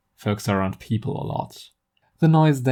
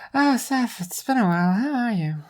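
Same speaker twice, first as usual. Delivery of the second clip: much higher, lilting friend on the phone; Zarya's bright voice; 13 semitones higher than usual. Acted. Friend answers Seth's call, high and bright. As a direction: greet an old friend, pitched way up.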